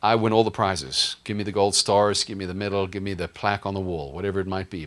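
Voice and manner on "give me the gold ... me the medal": ordinary talk, nothing marked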